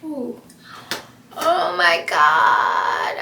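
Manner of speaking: dismayed gasp. Real, not acted. Mascara smudged under her eyes.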